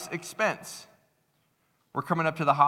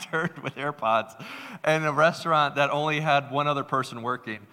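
ordinary delivery

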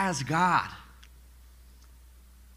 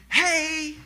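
a much higher, bright mock "Hey"; playful high angel greeting; angels calling hey; 10 semitones higher than usual. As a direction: call out like a cheery angel. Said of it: "It's not the angels going".

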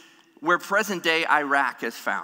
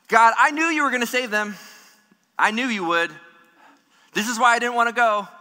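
higher, louder resentful outburst at God; angry Jonah; 5 semitones higher, 4 dB louder than usual. Acted. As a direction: complain bitterly, like he saw it coming. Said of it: "Can you sense the judgment in his heart".

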